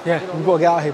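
ordinary talk

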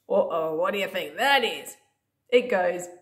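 usual speaking voice

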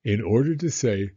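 plain reading voice

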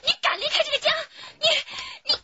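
high, loud tearful scream; wife screaming through sobs at husband leaving; crying shout. Acted. Wife weeps in quarrel.

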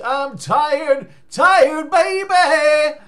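high, loud stretched cry; hammed-up belting. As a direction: belt it like a crooner. Sung-out complaint.

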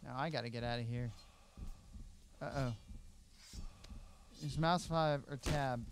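normal talking voice

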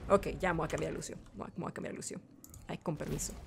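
very soft, low mumble to oneself; subdued muttering. Real, not acted. Player switching character after dying, subdued muttering. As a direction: drop to a resigned murmur.

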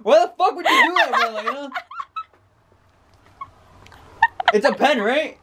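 high, laughing outrage; half laugh, half yell at her; outraged laughing. Real, not acted. Reacting to the prank reveal.

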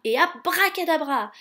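loud, high, ringing incantation; casting a spell. A narrator's reading. Storyteller as the wand transforms the mice, proclaiming. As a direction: proclaim the magic word with flourish. Magic word cast aloud.

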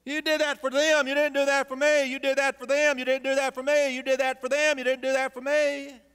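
high, petulant singsong complaint; jealous whining son. Acted. Elder son whining to his father about favouritism, petulant and high. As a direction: whine it like a jealous child.

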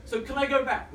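high, hopeful acted plea; timid question pitched well up; scared pleading. Nervous jumper asking instructor to go back, pleading. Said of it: "I'm scared".

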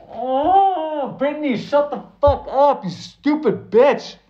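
exasperated mock outburst. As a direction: fling it at her in comic disgust, way up high. Yelling at imagined Brittany.

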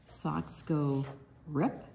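ordinary delivery, nothing marked

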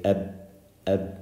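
"eb" stopped on a closed b; unreleased b ending "eb"; no bounce. Shown as a demonstration. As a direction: shut the lips on "eb" without releasing it. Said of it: "if we didn't do it, you wouldn't hear the letter".

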